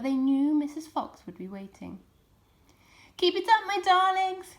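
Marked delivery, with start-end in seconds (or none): 3.2-4.6 high, loud encouragement; shouting. A narrator's reading. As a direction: shout it back to the little ones. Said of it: "shouted Mister Fox".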